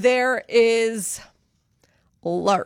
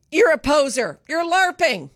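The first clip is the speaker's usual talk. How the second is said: higher-pitched playful accusing call; playful finger-pointing accusation; mock accusation; 7 semitones higher than usual. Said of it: "used to accuse someone".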